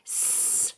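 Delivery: long unvoiced S hiss, like a snake; drawn-out s like a snake. Shown as a demonstration. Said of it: "we know what it sounds like".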